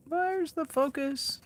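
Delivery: high, slow, dopey camera voice. Pretend. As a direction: play the confused camera. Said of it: "my camera just decides to go".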